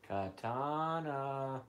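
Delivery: coaxing call. Real, not acted. Calling cat softly.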